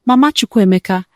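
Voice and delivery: ordinary delivery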